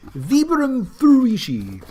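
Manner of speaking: high, slow, drawn-out product announcement; grand, loud sing-out of the name; announcer-style hype. Pretend. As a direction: belt out the name, stretched and high.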